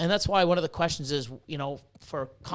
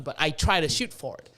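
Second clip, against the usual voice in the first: normal talking voice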